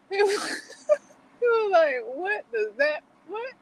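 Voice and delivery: high voice squeezed by laughter; giggling retelling. Real, not acted. Fan imitating the narrator's confusion, giggling. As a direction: giggle through the imitated baffled reaction.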